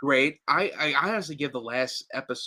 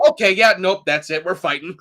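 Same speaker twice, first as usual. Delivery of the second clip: brisk refusal; 7 semitones higher, 7 dB louder than usual. Retold or imitated. Voices Jade's snap decision to fight.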